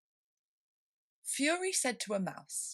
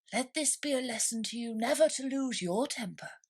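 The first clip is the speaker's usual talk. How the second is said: lightly voiced old crab; 6 semitones higher than usual.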